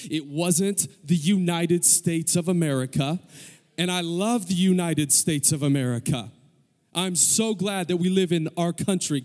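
loud, driving declaration to a crowd; emphatic preaching. Real, not acted. Preacher telling the congregation what Christians believe, emphatic preaching. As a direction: build to a forceful, ringing point.

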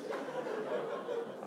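a quiet breathy chuckle under the breath; small hushed laugh; soft breathy laugh. Real, not acted. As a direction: laugh softly to himself. Laughing at his own boast.

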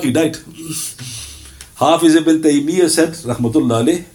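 usual speaking voice

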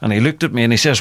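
normal talking voice

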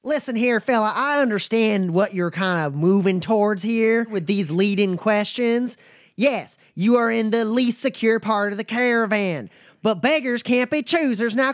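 a wary but friendly trail boss sizing folks up; nomad Bumper. Acted. Bumper answers Roy.

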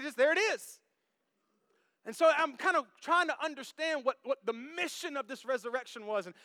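ordinary delivery

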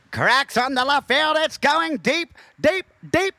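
loud, high, rising home-run call; play-by-play call. Pretend. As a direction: call the homer like a hyped announcer. Mock baseball play-by-play call.

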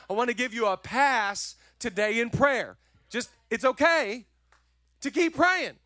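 high, loud preacher's build on keep praying; urging the congregation to keep praying, loudly; full-force preaching. Acted. Pastor exhorting the church, high and loud preaching.

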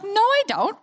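high-pitched mock protest; indignant denial. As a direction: push back playfully, pitched well up. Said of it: "I'm like".

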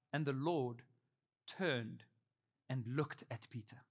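low, soft and broken by pauses; hushed solemn reading. A narrator's reading. Solemn reading of the climactic verse.

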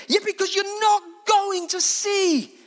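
high, slow, emphatic shout; impassioned preacher's cry; shouted retort. Acted. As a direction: fire back loudly, stretching the words.

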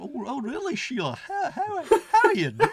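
high, hammed-up Renfrewshire chat; Renfrewshire accent. Mimicking talk with Sheila, high Renfrewshire accent. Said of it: "very convincing Renfrewshire accent".

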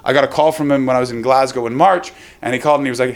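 normal talking voice

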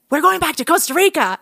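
high, thrilled imitation of a friend's news; retold burst of excitement; excited announcement. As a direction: announce it giddily, pitch up.

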